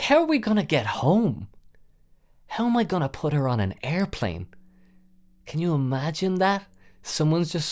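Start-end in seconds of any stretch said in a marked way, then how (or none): 0.0-4.5 higher, worried pitch climbing; panicked worry. Retold or imitated. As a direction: fret about getting her home. Panicking about getting home.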